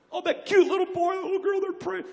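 high, mock cooing over a child; cooing adult. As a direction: gush over the little one.

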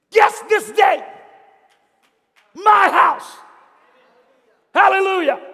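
high, loud joyful shout; excited shouting. A narrator's reading. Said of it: "he found himself shouting".

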